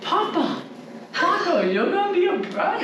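high, happy voice for the dog; excited dog talk. Real, not acted. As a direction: gush at the pet. Telling the dog the news.

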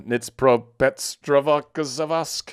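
"Nitpropetrovsk" sounded out very slowly, syllable by syllable, in a put-on voice; unsure pronunciation. Real, not acted. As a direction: drag "Nitpropetrovsk" out slowly, guessing at each syllable. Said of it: "I don't know how to say this one".

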